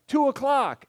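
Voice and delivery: high, loud call over engine noise; shouting over a motor. Retold or imitated. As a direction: yell the bearing over the roar of the motor. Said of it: "he shouts at me over the motor".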